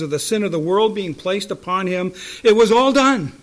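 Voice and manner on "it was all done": loud, high preaching cry; emphatic shout. Real, not acted. Preacher declares the suffering over, emphatic shout. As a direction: shout it with finality.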